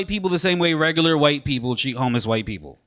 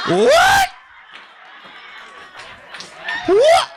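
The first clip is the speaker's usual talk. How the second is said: very high, loud squeal; shocked; 4 dB louder than usual. Acted. Comic reacting to her compliment, high loud shock. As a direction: yelp it high in disbelief.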